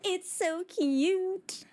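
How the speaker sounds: cooing. Real, not acted. Cooing over cute creature.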